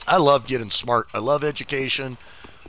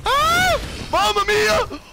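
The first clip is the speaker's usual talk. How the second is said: high panicked screams; scared horror game streamer; 11 semitones higher, 3 dB louder than usual. Real, not acted. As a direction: shriek in terror at the enemies.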